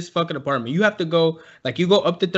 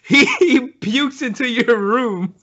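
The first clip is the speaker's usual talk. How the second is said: laughing; 6 semitones higher, slower than usual. Real, not acted. Laughing while speaking.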